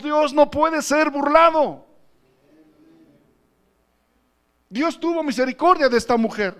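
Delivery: loud, high-pitched impassioned sermon; full-force preaching. Real, not acted. Preacher warns brothers that God is not mocked, impassioned loud preaching. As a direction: exhort the congregation at full voice.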